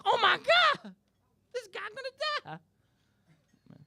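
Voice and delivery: shrieking high and loud, then stretched out slow; panicked soul-singer screech. Retold or imitated. [name] yelling ringside.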